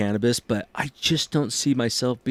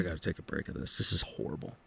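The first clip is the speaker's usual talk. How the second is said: muttering to himself; 5 semitones lower, 15 dB quieter, faster than usual. Retold or imitated. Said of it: "I'm just like".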